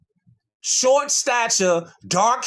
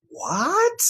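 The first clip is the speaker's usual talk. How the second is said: mock surprise; 11 semitones higher than usual. Real, not acted. Reacting to the history passage, in mock disbelief. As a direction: act stunned by what you just read.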